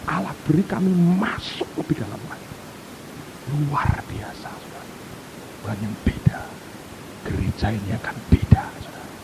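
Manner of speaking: low, hushed testimony; breathy hushed preaching; awed whisper. Real, not acted. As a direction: whisper it in reverence. Awed testimony of God's presence.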